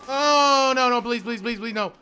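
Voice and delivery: loud, high plea fading down; panicked begging. Real, not acted. Player begging the game for mercy, panicked. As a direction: beg in real terror then trail off.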